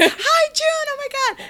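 high, loud gushing fangirl greeting; starstruck squeal. Retold or imitated. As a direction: squeal his name like you can't believe it. Greeting idol June at hi-touch.

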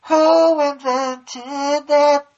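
very high, stretched-out shout; huge, long-held, hammed-up yell; booming title announcement. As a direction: belt it out like a game show title. Announces the new segment's title.

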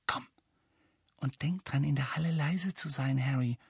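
breathy hushed whisper. A narrator's reading. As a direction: whisper it urgently. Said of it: "flüsterte Hermine".

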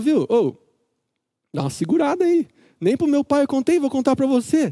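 brushing her off, imitating Samson; hammed-up dismissive husband; curt Samson. Samson's curt retort voiced.

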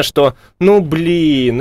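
usual speaking voice

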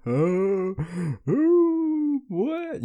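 high squeaky nervous giggle then what; nervous laugh. Retold or imitated. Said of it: "them nervous laughs".

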